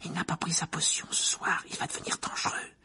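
quick scared whisper, pace picking up; frightened whisper. Acted. Hermione warns about the missed potion, fast frightened whisper. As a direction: hurry the warning out in a whisper. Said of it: "bredouilla Hermione".

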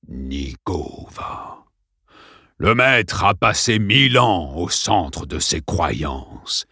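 very slow, calm menace; cold principal. Acted. Principal mocks Dale after the water, slow and cold. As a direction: savor each word, icy.

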